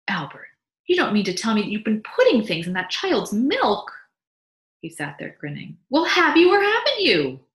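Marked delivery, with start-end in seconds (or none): 0.1-3.9 louder, higher worried wife; a mother horrified about her baby's milk; alarmed wife. Acted. Mabel voiced, alarmed. 5.9-7.5 much higher, louder and slow; demanding wife. Acted. Mabel demanding an answer.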